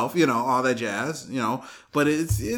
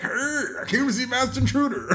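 put-on higher, eager old-timer voice; old fan; 9 semitones higher than usual. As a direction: announce it proudly.

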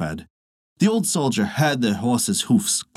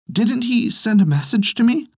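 put-on much higher eager old woman; old wife's voice; 11 semitones higher than usual. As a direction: ask hopefully like a doting wife. Old wife asks for message.

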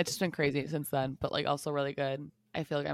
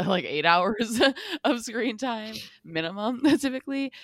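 giggling through the words, higher and louder; laughing; 7 semitones higher, 8 dB louder than usual. Real, not acted. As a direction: laugh while you say it. Laughing at own screen time.

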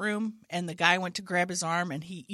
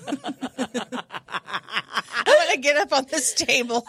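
laughing through the words; giggling while she talks; 5 semitones higher, 5 dB louder than usual. Real, not acted. Laughing at own joke.

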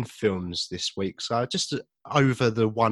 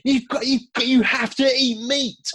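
high, loud mock-outraged rant; hostile outburst; 13 semitones higher, 6 dB louder, slower than usual. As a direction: act out an indignant anti-vegan tirade. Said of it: "how hostile people are towards vegans".

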